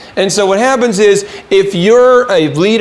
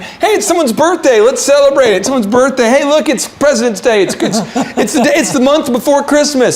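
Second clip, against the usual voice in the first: higher, bubbly and eager, speeding up in places; party guy; 6 semitones higher than usual. Retold or imitated. Imitating Captain Fun rallying the office, in a high excited voice. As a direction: hype every holiday like it's a party. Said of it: "Captain Fun".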